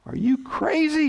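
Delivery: high-pitched exclamation, no real volume; mock panicked shout. Panicked castaway to his relaxed companion, high mock shout. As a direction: cry out high in mock disbelief. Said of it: "shouted the first man".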